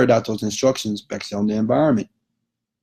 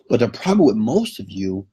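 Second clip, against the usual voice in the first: ordinary talk, nothing marked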